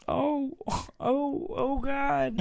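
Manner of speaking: high mock-moaning cartoon voice; cartoon character impression. Co-host joking about dirty talk in cartoon voices, high mock moan. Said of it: "voices would be doing it for me".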